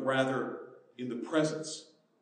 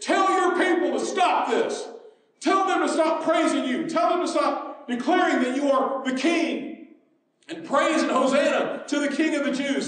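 calling out indignantly; 11 semitones higher, 7 dB louder than usual. Acted. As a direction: shout the demand over a crowd. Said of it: "They called out to him".

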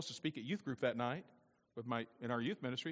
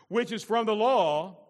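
loud, high preacher's proclamation; raised voice, emphatic scripture delivery; forceful scripture reading; 8 semitones higher, 14 dB louder, slower than usual. Real, not acted. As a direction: declaim the verse with full pulpit force.

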